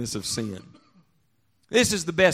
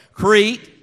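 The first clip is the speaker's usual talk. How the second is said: louder, full-voiced shout of Crete; say it like you mean it; 4 semitones higher, 11 dB louder than usual. Shown as a demonstration. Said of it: "I want you to say it like you mean it".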